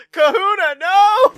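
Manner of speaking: high, loud mock cry of dismay; a shrieked mock protest; mock horrified cry. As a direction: cry out in hammed-up horror.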